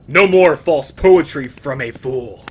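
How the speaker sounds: loud put-on voice; cold taunt. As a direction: spit it at the fool. Hero's taunt.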